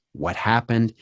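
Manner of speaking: ordinary delivery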